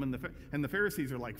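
normal talking voice

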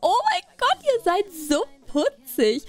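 very high, squeaky cooing; cutesy high voice. Acted. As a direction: coo at a cute little horse. Cooing at the two horses.

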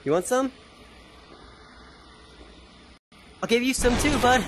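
high mock challenge; playful taunt. Player threatens a game enemy, playfully taunting. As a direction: offer a beating in a silly high voice.

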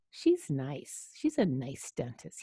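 plain reading voice

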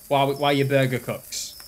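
ordinary delivery

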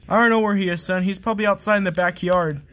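usual speaking voice

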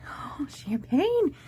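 slow, breathless whisper of the name; emotional whisper. Real, not acted. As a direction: barely voice it, overcome. Overwhelmed, says the sender's name.

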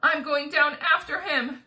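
loud, determined call; cried out. A narrator's reading. James goes down after the centipede, crying out. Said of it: "cried James".

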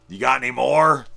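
loud, high mock junkie plea; begging undead junkie. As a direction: blurt it out as a drug-hungry corpse. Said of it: "and he's like".

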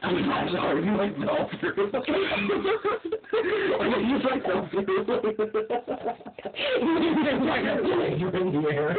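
high, loud laughing fits; helpless giggling through the words; laughing hysterically. Real, not acted. Cracking up at fall.

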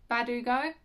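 ordinary talk

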